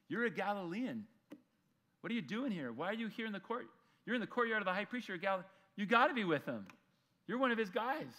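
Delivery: high, insistent mock accusation; acted accusation. Bystanders cornering Peter in the courtyard, accusing.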